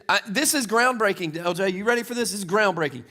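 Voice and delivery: usual speaking voice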